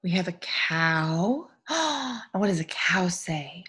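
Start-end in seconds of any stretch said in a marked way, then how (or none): 1.7-2.3 mock-surprised gasp; excited gasp. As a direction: gasp as if discovering something wonderful. Surprise at new picture for children.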